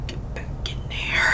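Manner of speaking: a soft, voiceless scolding aside; whisper. Real, not acted. Vlogger tearing up whispers at her welling tear. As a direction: whisper to the tear, willing it back.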